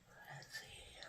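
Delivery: barely audible whispered aside. Real, not acted. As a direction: breathe it out to yourself. Unvoiced aside while working.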